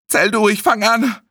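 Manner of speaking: high-pitched emergency order; urgent command. Acted. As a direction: order someone to start immediately. Befehle bei der Wiederbelebung. (dringlicher Befehl)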